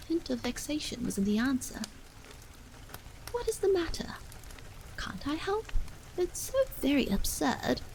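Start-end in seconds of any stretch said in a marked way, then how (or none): 3.3-7.8 wife's voice pitched higher, anxious and pressing; a worried wife pleading through a door; higher anxious woman calling through a closed door. A narrator's reading.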